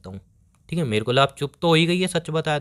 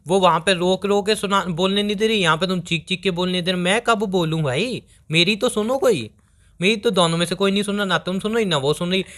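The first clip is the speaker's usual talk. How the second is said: exasperated protest; 2 semitones higher than usual. Acted. Boyfriend caught between two women pleads to be heard, exasperated. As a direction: protest like you can't get a word in.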